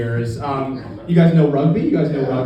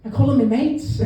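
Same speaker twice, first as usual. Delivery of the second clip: put-on higher-pitched Liverpool kid; Liverpool accent; 9 semitones higher than usual. Liverpool kid answering a rugby teammate, high Scouse accent. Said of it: "the kid from Liverpool".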